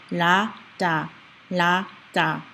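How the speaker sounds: "la" and "da" back to back, evenly spaced; L-D alternation. Shown as a demonstration. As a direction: alternate "la, da" as separate syllables. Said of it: "say them back to back".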